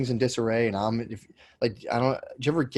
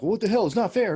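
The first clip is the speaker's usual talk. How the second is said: put-on high, louder childish complaint; mock whiny protest; 11 semitones higher, 4 dB louder than usual. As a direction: whine it like a sulking kid. His whiny protest over nothing.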